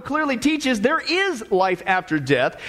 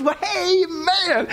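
higher-pitched, drawn-out happy greeting; joyful welcome; 7 semitones higher, much slower than usual. Acted. Saint Peter greeting the taxi driver, joyful. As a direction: greet him with hammed-up delight. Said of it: "he smiles joyfully and he says".

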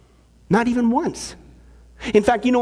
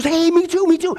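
excited; 7 semitones higher than usual. Retold or imitated. Eager joke character, excited.

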